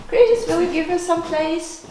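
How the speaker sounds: ordinary delivery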